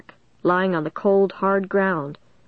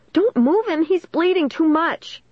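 high-pitched alarmed cry; panicked call; 10 semitones higher than usual. A narrator's reading. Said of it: "Caitlin called in panic".

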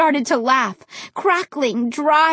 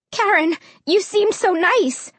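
put-on higher-pitched, pleading girl; tumbling out; 8 semitones higher than usual. A narrator's reading. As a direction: blurt it out without thinking. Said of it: "words just tumbled out".

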